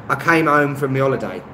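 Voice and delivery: London accent with "home" as 'ome and "holiday" as 'oliday; casual London accent, no H on home or holiday; London accent, dropped H. Shown as a demonstration. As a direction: drop every H like a Londoner. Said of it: "you don't do the H".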